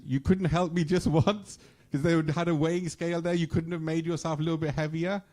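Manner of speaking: high, loud retold reproach; hammed-up, high-pitched grievance; indignant complaint. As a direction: act out an aggrieved devotee's protest, pitched well up. Acted complaint of Surdas to Krishna.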